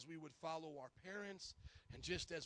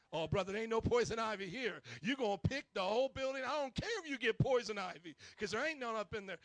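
a hint of a stern drill-sergeant brother, louder; 3 semitones higher, 8 dB louder than usual. Brother T ordering the pastor to weed, lightly voiced stern order. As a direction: bark it flatly like a drill sergeant. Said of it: "talk like Brother T".

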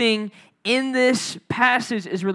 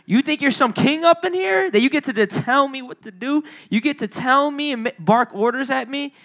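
higher-pitched, affronted mom; indignant mother; 4 semitones higher than usual. Acted. Mother answering bossy child, indignant. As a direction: challenge the child, pitched up in disbelief. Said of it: "She'd probably be like".

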